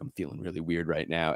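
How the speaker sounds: low, soft, hushed confession; mock uneasy medium. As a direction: murmur it like a spooked ghost hunter. Medium sensing a presence, acted.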